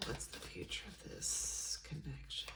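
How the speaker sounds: almost soundless, breathy repeat; barely breathed question. Real, not acted. Reader to her tarot deck, repeating the question barely audibly.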